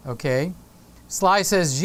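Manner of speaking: ordinary talk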